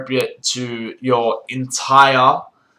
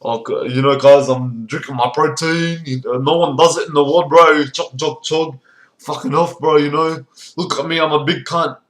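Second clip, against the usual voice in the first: lightly voiced bragging gym bro; mock a protein-chugging lad; meathead gym bro; 3 dB louder than usual.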